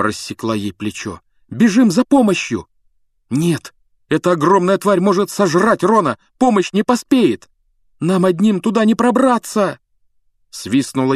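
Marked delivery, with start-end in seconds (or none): 1.5-2.7 frightened cry. Acted. Frightened girl urging help. 3.3-9.8 urgent shout. Acted. Boy refusing in panic.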